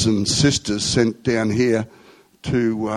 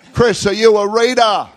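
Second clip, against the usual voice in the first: high, raised call to a neighbour; retold calling out; 10 semitones higher than usual. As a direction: call the question up to someone floors above.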